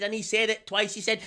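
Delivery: usual speaking voice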